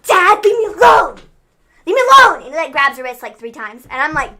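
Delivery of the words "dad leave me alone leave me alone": louder pleading cry; mock shout. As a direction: yell it like a defiant teen. Aaliyah shouting at dad.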